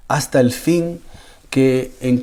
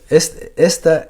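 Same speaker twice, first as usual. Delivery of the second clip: usual speaking voice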